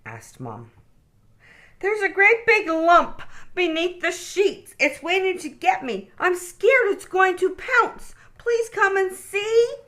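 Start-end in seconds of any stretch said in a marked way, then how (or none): 1.8-9.8 high, scared little bunny voice; sniffing scared. A narrator's reading. Okie begging Mom to check the bed, high and scared. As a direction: plead tearfully for help. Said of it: "sniffed Okie".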